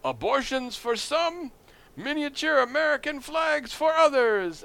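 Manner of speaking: high, slow, grand compromise announcement; Bob Dole alien candidate. Retold or imitated. Candidate appeasing a split crowd, high hammed-up proclamation. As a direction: proclaim each half with a pause between. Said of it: "he pauses and he goes".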